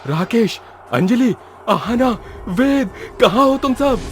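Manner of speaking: calling out for missing friends. A narrator's reading. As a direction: call each name, lifting at the end. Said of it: "वैभव आवाज लगा ही रहा था". (दोस्तों को पुकारते हुए)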